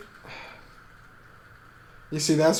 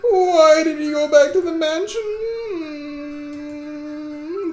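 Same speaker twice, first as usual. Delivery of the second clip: high, loud hammed-up distressed wife; tearful, high-pitched panicked cry; panicked wailing; 8 dB louder than usual. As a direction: wail in panic, calling out for your husband. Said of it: "they heard Ava's panicked voice".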